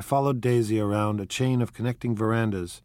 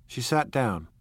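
plain reading voice

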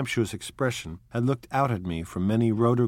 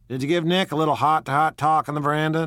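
louder and fast, a pushy demand; sudden demand; 6 semitones higher, 5 dB louder, faster than usual. Acted. Tom abruptly presses Daisy about the veranda, demanding. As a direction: fire the question at her. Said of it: "demanded Tom suddenly".

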